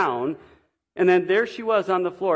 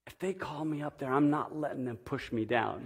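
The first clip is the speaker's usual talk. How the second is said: hushed, much softer retelling of a whisper; softly imitating a whisper in church; retold whisper; 2 semitones lower, 8 dB quieter than usual. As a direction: drop the voice to pass on a secret. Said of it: "she whispers to me".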